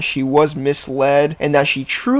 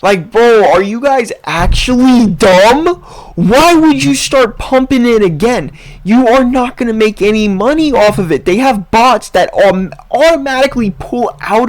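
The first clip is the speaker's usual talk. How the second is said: louder, higher, disbelieving; exasperated rant; 5 semitones higher, 4 dB louder than usual. Real, not acted. Vlogger ranting at crypto investors, exasperated and loud. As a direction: scold the investors like they're fools.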